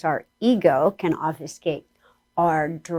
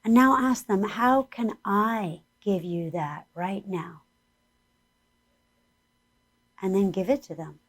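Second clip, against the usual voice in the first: guided meditation; 3 semitones higher than usual. Acted. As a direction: lead the listeners gently inward.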